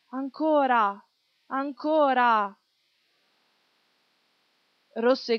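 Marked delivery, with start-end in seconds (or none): 0.1-1.0 a bit louder, higher call; crowd shout. A narrator's reading. Audience demanding more from the stage, eager shout. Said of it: "Il pubblico gridava". 1.5-2.6 slow, stretched call for more; drawn-out shout. A narrator's reading. Audience begging the show to go on, slow drawn-out shout. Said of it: "Il pubblico gridava".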